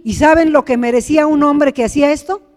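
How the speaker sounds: ordinary talk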